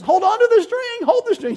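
high, loud pleading cry to hold on; mother begging not to let go; desperate plea. Retold or imitated. Mother pleading with son's father at wedding, high loud cry.

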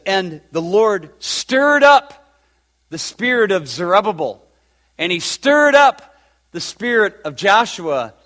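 scripture read rising to full force; preaching. Acted. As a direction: swell it up like a revival preacher. Reads scripture building up.

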